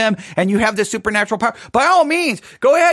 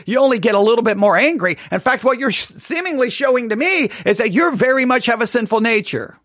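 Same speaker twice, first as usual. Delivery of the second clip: ordinary talk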